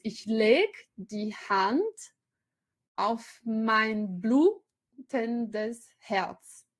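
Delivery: Ich leg die Hand auf mein blutendes Herz, drawn out, weight on leg and mein; slow scansion attempt. Shown as a demonstration. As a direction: lecturer tries reading the hard verse aloud, very slow. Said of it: "vou tentar".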